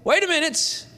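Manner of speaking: sudden high halt, wait; a light voiced interruption; Holy Spirit interrupting. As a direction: cut in sharply, then settle. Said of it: "the Holy Spirit goes".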